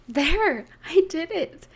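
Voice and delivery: loud and pleased, a sudden burst; proud shout of I did it; excited triumph. Acted. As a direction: cheer at your own small win. First successful piped cookie.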